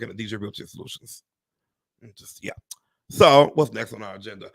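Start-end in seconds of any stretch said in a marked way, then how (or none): 2.0-2.5 unvoiced whispered aside. Real, not acted. Sharing a quiet aside with viewers, whispered. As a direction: drop to a whisper.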